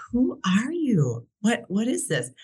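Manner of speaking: amazed disbelief. Retold or imitated. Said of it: "And I was like".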